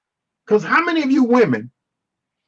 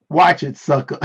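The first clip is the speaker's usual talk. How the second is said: low sassy warning through a laugh; mock-tough threat, lightly voiced; Esther; 4 semitones lower than usual. Teasing someone in the online chat, lightly voiced Esther impression. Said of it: "In my Esther voice".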